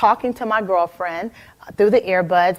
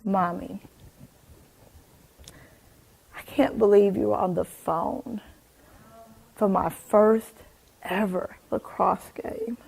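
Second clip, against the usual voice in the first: put-on soft, hushed little girl's reproach; a child quietly letting mom down; hushed disappointed child; daughter's hurt words; 7 dB quieter than usual. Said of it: "and she goes".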